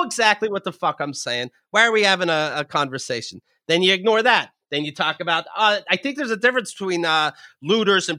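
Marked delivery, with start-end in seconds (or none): none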